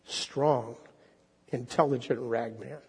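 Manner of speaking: expressive storyteller read aloud; story reading. Acted. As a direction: draw the congregation into the tale. Preacher reads The Rag Man aloud.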